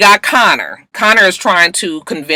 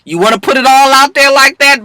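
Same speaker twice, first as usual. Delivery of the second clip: mock threat; 9 semitones higher, 5 dB louder than usual. DA threatening Bonnie.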